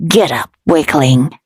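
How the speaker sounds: low, scornful demonic taunt; devil voice. A narrator's reading. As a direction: sneer down at him.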